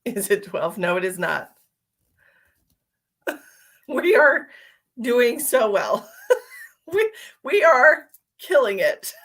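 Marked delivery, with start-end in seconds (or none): 3.3-9.1 giggling through the words, higher and louder; laughing. Real, not acted. Streamer to viewers, cracking up with laughter. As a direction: laugh helplessly as the game falls apart. Giggling over the game going badly.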